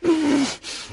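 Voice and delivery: shrieking high laugh, loud and cruel; a manic laugh of triumph; wild laugh. Acted. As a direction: cackle with glee at the violence. After striking with the glass.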